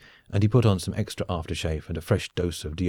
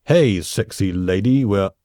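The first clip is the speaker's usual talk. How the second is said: loud, slow, drawn-out come-on; cheesy seducer; 6 dB louder, slower than usual. A narrator's reading. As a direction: make a swaggering bedroom entrance. Bursting in on his expected date.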